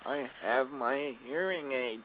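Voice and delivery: put-on high, slow little old lady caller. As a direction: play a frail old lady phoning in. Old lady complains about loudness.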